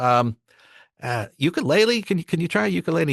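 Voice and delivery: mock the producer fumbling for an instrument; clueless producer.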